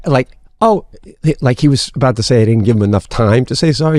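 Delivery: ordinary talk, nothing marked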